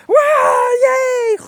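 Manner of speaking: high, loud gleeful whoop; excited dog. Retold or imitated. Dog pouncing on dropped pills, high loud glee. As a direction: squeal like a dog grabbing pills. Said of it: "the dog shouldn't be going".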